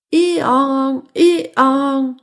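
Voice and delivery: imitated hee-haw; donkey bray. (braiment d'âne imité)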